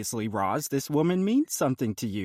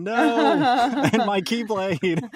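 laughing; 8 dB louder, much slower than usual. Real, not acted. Laughing mid-turn at the table.

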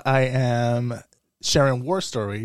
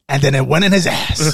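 raised-voice bawdy war story; loud storytelling; 2 semitones higher, 5 dB louder than usual. Acted. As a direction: boom out the punchline at the bar. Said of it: "loud as hell".

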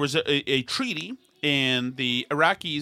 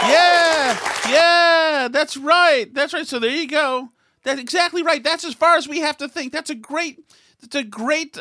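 high mocking sing-song yeah-yeah; sarcastic high agreement; 11 semitones higher, 7 dB louder than usual. Podcaster mocking the other side's simple logic, high sarcastic voice.

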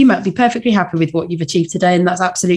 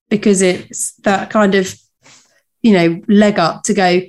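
ordinary talk

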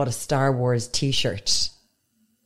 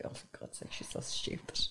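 whispered exasperated dad; exasperated mutter; 19 dB quieter than usual. Retold or imitated. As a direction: sigh it out under your breath like a fed-up father. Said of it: "He was just like".

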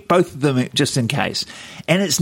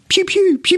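finger-gun pew sounds. Retold or imitated. Showing a friend Ben's gaming intro, high pew-pew zaps. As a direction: fire off playful toy-gun noises. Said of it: "with finger guns".

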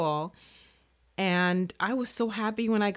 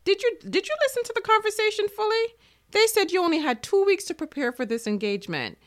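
higher, acted incredulous self-scolding; scolding inner voice; 5 semitones higher than usual. Little voice doubting she can prepare, acted scolding. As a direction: tell yourself off, starting high and quick. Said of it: "my little voice kicked in and said".

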